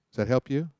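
ordinary talk, nothing marked

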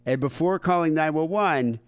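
ordinary delivery

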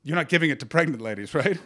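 usual speaking voice

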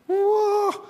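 hoch und lauter gerufenes Staunen; staunendes Wow. Real, not acted. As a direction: echt beeindruckt ausrufen. Amazed by the baby whisperer. (hohes, staunendes „Wow“)

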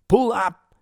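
loud, high urgent yell; acted high shout of warning. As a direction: yell the command from the jump seat. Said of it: "he yelled".

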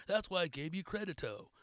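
usual speaking voice

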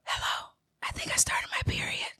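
hushed phone whisper. Retold or imitated. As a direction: whisper it so nobody hears. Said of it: "I whispered into the phone".

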